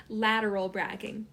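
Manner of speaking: ordinary delivery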